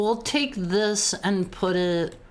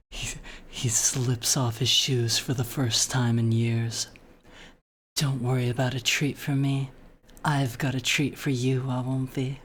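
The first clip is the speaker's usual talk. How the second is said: low, hushed storyteller voice, lower and softer than her chat; romantic story reading; 9 semitones lower, 3 dB quieter than usual. Acted. Streamer reads fan fiction to chat in a low romantic narration voice. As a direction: read it like an intimate bedtime romance.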